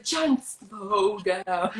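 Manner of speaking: very slow, stretched-out imitation; Jesse Griffiths. Casting director to auditioning actor, exaggeratedly slow. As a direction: drag out every word to make the point. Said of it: "Jesse Griffiths ... sometimes is like".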